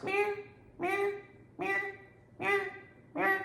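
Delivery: high imitated cat meows, repeated; cat meowing. Imitating the cat meowing at her door at three forty-five. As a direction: mimic the cat yowling at night. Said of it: "she starts".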